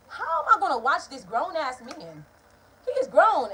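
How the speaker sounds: loud, slow exasperated protest; arguing back. Retold or imitated. Daughter defending herself to her mother, loud exasperated protest. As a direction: push back hard, fed up.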